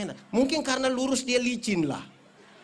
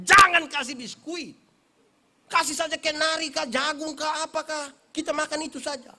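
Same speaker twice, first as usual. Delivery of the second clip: mock instruction; 7 semitones higher, 6 dB louder than usual. Preacher telling village hosts not to serve biscuits, playful higher command. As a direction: playfully order the hosts around. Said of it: "Saya bilang begini".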